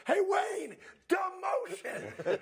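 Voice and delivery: high, drawn-out call across the field. Retold or imitated. Quarterback calling to his rookie across the field, high and slow. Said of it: "So he goes".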